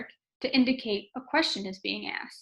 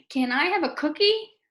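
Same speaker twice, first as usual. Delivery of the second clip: "Can I have a cookie?" with a higher, rising end on "cookie"; asked as question; 5 semitones higher, 5 dB louder than usual. Shown as a demonstration. Teacher reading "Can I have a cookie?" for students, clearly questioning. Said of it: "make it really emphasize that it's a question".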